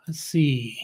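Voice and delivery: whisper. Real, not acted. Muttering to himself while reading chat.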